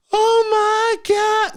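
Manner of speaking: high, slow, whiny mock sob; hammed-up high sobbing voice; mock crying. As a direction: wail it like a fake cry. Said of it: "she starts crying".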